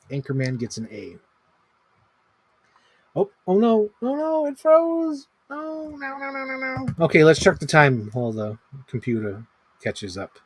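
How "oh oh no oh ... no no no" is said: much higher, strained oh no; real dismay. Real, not acted. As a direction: panic as the screen freezes. Computer freezes mid-video.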